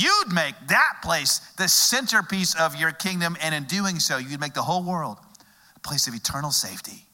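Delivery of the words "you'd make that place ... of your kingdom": ordinary talk, nothing marked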